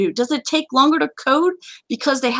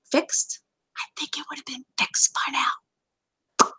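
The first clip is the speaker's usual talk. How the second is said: hushed aside, nearly all breath; confiding whisper; 3 semitones higher, 7 dB quieter, slower than usual. Real, not acted. Host hinting why a glitch remains unfixed, confiding whisper. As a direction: drop into a slow, pointed whisper. Confiding a hint about the developer.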